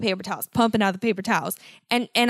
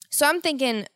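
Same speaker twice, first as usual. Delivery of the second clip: usual speaking voice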